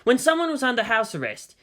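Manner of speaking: plain reading voice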